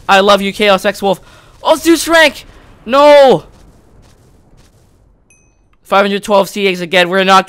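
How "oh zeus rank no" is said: high, loud excited cry turning to dismay; dismayed shout. Real, not acted. Gamer watching a Zeus rank go by, dismayed shout. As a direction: shout out as the prize slips away. Rank appears then slips away.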